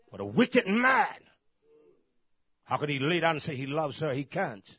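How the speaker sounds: loud, indignant pulpit denunciation; forceful preaching. Real, not acted. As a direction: preach it hard, punching key words.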